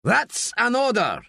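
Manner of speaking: high, firm barked order; commanding shout. A narrator's reading. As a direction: pull rank at the top of the voice. Quesada still shouting orders.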